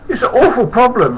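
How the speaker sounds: very high, loud mimicked wail; mock-distressed high voice; worried Huey. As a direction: squeal it as a fretting workmate. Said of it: "He says".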